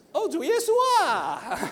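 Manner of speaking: mock odd tune, pitched high and swooping; lightly mocking sing-song; strange sing-song tone. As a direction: imitate a child's weird churchy intonation. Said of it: "有怪怪的音调".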